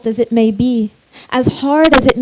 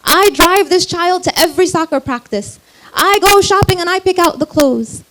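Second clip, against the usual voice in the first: higher-pitched, insistent retold complaint; aggrieved mother; 4 semitones higher, 3 dB louder than usual. Hurt mother defending herself to a counsellor, aggrieved. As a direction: imitate a mother protesting all she does. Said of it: "so often the mother will say".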